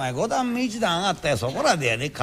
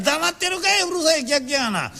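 higher, mocking heckle from the audience; a rowdy Osaka heckler; heckling customer; 8 semitones higher than usual. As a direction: jeer at the reciter. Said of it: "客黙ってたから".

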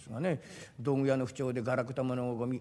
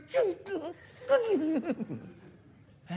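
much higher, hesitant woman's voice; shy young woman; 13 semitones higher than usual. Retold or imitated. Young woman in the toilet calling junkman, high and shy. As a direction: call timidly from behind the door. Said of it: "気兼ねしながら小さな声で".

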